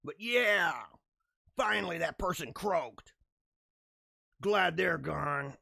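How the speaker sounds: mock callous. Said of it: "You can sit there and say".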